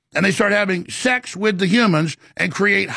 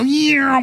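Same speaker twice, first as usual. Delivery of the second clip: imitated orb whoosh; 6 semitones higher than usual. Describing orbs flying off at dawn, higher playful whoosh. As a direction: mimic the orbs zipping off with a quick vocal whoosh.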